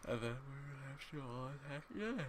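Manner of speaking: talking through a yawn. Real, not acted. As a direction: stretch the words in a yawn. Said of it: "Sorry about the yawning".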